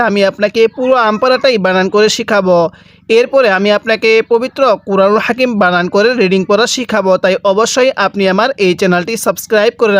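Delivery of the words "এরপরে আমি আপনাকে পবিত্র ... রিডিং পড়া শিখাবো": usual speaking voice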